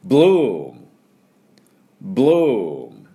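"ブルーム" as English bloom, loud and stretched; long swooping oo into a closed m; pronunciation model. Shown as a demonstration. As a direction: give a loud, slow BLOOM to repeat after. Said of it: "で、行きますよ".

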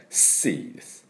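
シー with a soft hissing s; whispered s sea. Shown as a demonstration. Teacher showing the correct シー. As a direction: breathe out a quiet s in シー. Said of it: "シーでありません。".

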